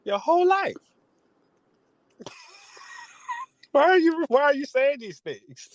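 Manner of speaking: a father scolding his son over his lyrics, high and outraged; outraged dad. Retold or imitated. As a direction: squeal it like a scandalized parent. Voicing Michael Irvin berating son.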